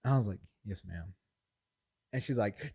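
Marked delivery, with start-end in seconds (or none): 0.6-1.1 low, soft, chastened reply; meek submission. Retold or imitated. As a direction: answer small, like a scolded son. Retold submission to scolding mom.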